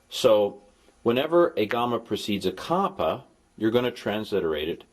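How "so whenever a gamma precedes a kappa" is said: normal talking voice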